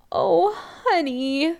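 high, drawn-out, touched mother; teary. A narrator's reading. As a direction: choke up fondly, stretching the words. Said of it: "she sounds teary".

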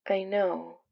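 very soft, understated sadness; sad, almost wistful. A narrator's reading. Katie accepting her ex's confession, sad and wistful. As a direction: say it quietly, a little wistful. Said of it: "Katie sounds sad, almost wistful".

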